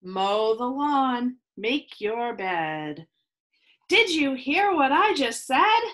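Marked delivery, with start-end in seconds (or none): none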